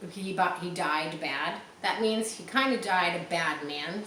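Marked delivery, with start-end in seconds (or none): none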